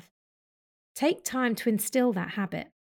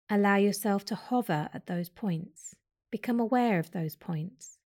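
guided meditation. Acted. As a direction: lead their attention to the breath calmly.

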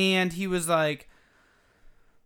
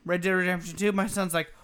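normal talking voice

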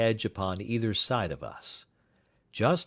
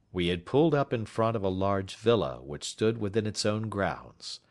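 ordinary delivery

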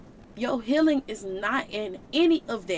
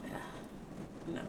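whispered brush-off; 15 dB quieter, much slower than usual. Retold or imitated. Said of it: "And I'm just like".

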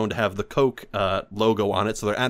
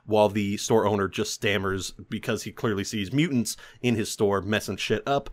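ordinary delivery

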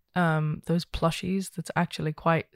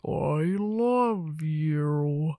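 slowed toy voice; much slower than usual. Retold or imitated. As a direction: stretch it out like a broken voice box. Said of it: "I started going".

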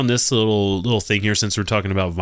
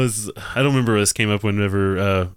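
ordinary delivery

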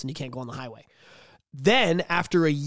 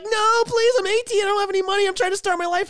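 put-on pleading teen; 4 dB louder than usual. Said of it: "and you're like".

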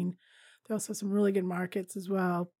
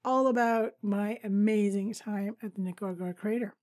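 ordinary talk

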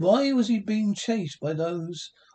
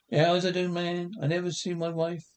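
ordinary delivery

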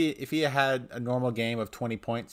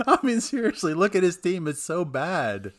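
exasperated outburst; 8 semitones higher, 6 dB louder than usual. Real, not acted. Ranting about his rival's team.

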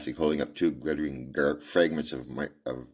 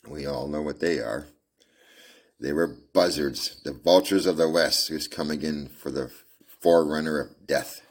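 ordinary delivery

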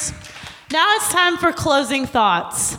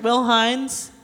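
normal talking voice

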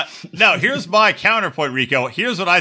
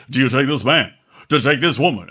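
[name] impression; 3 semitones lower than usual. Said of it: "talk like [name]".

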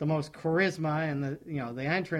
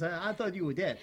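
lightly voiced wrestler imitation; mock wrestler voice through a laugh; terrible Mark Briscoe; 3 semitones higher, 4 dB quieter than usual. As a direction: try a half-hearted, jokey wrestler impression. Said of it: "Terrible, terrible".

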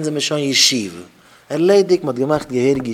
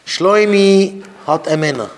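calling out announcement; 5 semitones higher, 5 dB louder than usual. Acted. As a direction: proclaim it like a herald, name held long. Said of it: "און מען רופט אויס".

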